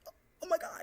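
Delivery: high, loud oh my God; shocked gasp. Retold or imitated. Learning he is nineteen, she gasps loudly. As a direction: blurt it out in shock. Reacting to his age reveal.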